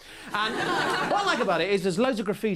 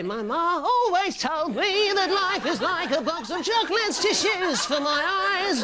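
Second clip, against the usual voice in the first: higher, slow, half-sung Bowie; [name]; 10 semitones higher, slower than usual. Acted. Comic doing Bowie for the crowd, slow and high. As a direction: croon it slowly like Bowie. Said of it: "[name]".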